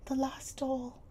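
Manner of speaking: put-on high, sad little girl's voice; mournful. A narrator's reading. Sarah mourning her last doll, high and sad. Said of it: "her little mournful voice had an odd sound".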